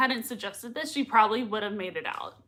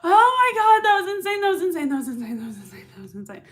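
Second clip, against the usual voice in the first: overwhelmed amazement, fading; 5 semitones higher, 4 dB louder, faster than usual. Real, not acted. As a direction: start shrieking, then run out of breath repeating it. Stunned reaction winding down.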